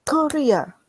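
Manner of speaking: high and loud, shouting a name; angry shout. Acted. China shouting at Korea about pandas. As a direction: yell across the dock.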